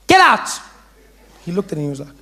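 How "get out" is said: high, loud teacher's shout; shouted order. Acted. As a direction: explode into a shouted dismissal.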